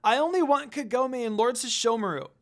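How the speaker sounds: loud, high pleading demand; girl refusing the monk's help, loud and stubborn; stubborn insistence. Acted. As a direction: insist like a defiant child. Stubborn child defying monk.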